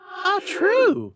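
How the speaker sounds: very high, otherworldly spirit; ghost voice. A narrator's reading. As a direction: float it like an eerie spirit. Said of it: "said the ghost".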